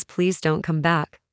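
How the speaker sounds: ordinary talk, nothing marked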